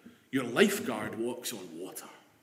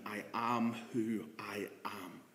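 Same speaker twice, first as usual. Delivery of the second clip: solemn hush; 5 semitones lower, 10 dB quieter, slower than usual. Acted. Quoting God to Moses.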